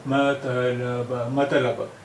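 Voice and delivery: मतलब drawn out very slowly, ma-ta-la-ba; मतलब sounded out at a crawl; slow spelling pronunciation. Shown as a demonstration. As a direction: stretch मतलब syllable by syllable. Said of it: "ये है".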